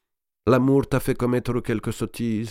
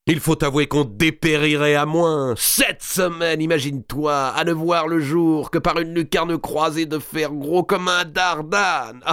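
loud, higher mocking noble ending in a laugh; boisterous lord; 9 semitones higher, 3 dB louder than usual. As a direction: gloat loudly about his release. Robert d'Artois recounting prison. (Robert d'Artois, fort et goguenard, puis rit)

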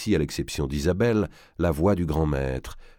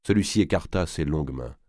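usual speaking voice

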